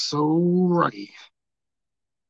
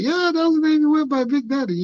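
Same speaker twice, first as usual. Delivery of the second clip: excited explaining; 12 semitones higher, 4 dB louder than usual. Retold or imitated. Explaining to coworker, animated.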